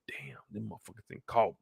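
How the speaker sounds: under your breath, realizing you're caught; caught-out mutter. Retold or imitated. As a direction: worker muttering when busted. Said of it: "You're like".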